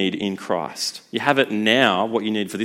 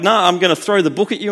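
higher, louder dismissive threat; mock threat; 6 semitones higher, 4 dB louder than usual. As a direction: brush off the plea and threaten. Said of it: "he's like".

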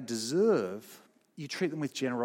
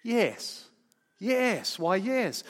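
bishop's yeses rising higher, easy and unhesitating; kindly bishop; 7 semitones higher, much slower than usual. Retold or imitated. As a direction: answer the police at once, without blinking. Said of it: "the bishop, almost without blinking, says".

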